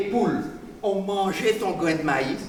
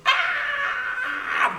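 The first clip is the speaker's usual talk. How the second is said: a sharp high kung fu yell; high martial-arts cry. Acted. Rooster striking the goat with a Shaolin kick, high kung fu yell.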